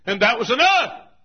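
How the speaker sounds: high, loud climactic refrain; emphatic shout. Real, not acted. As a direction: drive the refrain home at full voice.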